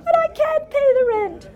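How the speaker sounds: very high, wailing damsel in distress; helpless damsel. Pretend. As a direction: plead like a silent-film heroine. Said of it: "this childish parody of melodrama".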